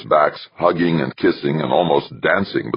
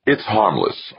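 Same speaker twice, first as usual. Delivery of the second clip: hasty, higher-pitched reassurance; quick addition; 8 semitones higher than usual. A narrator's reading. Archer reassuring Westfall, adding it quickly. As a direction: jump in to soothe her. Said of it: "Archer added quickly".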